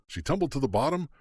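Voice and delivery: ordinary delivery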